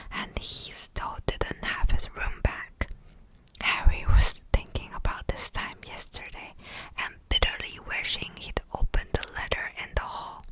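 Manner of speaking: usual speaking voice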